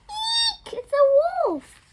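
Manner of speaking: high, loud, slowed squeal of fright; frightened shriek. A narrator's reading. Little Red screams on seeing the wolf, shrieking in fright.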